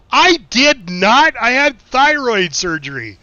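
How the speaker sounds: high, slow, loud put-on dad voice correcting his son; indignant dad. Dad overhears and corrects the boy, indignant and loud. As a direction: drag out I did not as the offended father. Dad denies the knife fight story.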